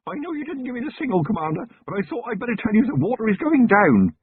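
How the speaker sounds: high, fast, breathless report; a junior officer blurting out news to his commander; eager young constable. A narrator's reading. Feeney bursts in reporting.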